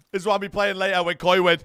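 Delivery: loud, high, fast hype; excited yelling. Real, not acted. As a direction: shout it, fired up. Yelling excitedly about the game.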